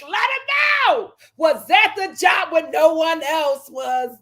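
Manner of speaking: slow, belted shouting with the lines dragged out; shouting the lyrics slowly for a friend; hyped-up cheering. Retold or imitated. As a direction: cheer her on like a hype woman at a concert. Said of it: "I said".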